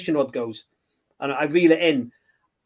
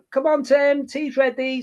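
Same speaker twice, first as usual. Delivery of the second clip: high, mock mum call; 10 semitones higher, slower than usual. Mum calling Tim in for tea, high sing-song call. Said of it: "Mum used to say".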